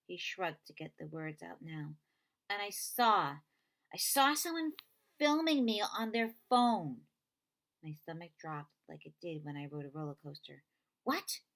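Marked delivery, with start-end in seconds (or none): 2.5-7.1 distressed. Acted. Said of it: "Connor telling of filming". 11.0-11.4 high sharp disbelief; shocked what. Acted. Avon shocked at Connor's news, high pitched. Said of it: "Avon shocked".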